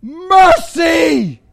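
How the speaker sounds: loud, high shout for mercy; crying out. Acted. Blind beggar begging Jesus for cure, crying out. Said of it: "He cries out to Jesus".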